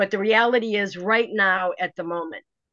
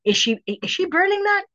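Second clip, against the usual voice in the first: anxious wondering; 6 semitones higher than usual. Retold or imitated. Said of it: "I'm like".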